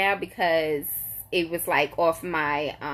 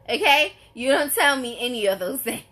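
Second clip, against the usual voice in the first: usual speaking voice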